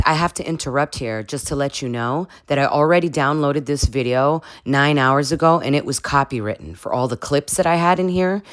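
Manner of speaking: normal talking voice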